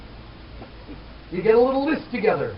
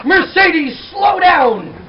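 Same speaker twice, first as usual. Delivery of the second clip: loud, high parental call; yelling at a child; 7 semitones higher, 5 dB louder, much slower than usual. Acted. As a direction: holler after a runaway kid. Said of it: "yelling at your child and yelling things like".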